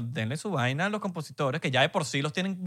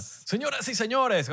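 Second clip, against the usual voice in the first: mocking radio announcer, higher and drawn out; nineties radio host; 7 semitones higher, slower than usual. Co-host teasing a friend's sign-off, mock radio host. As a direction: stretch it grandly, imitating a dated presenter. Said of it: "¿Un show de radio de los noventa?".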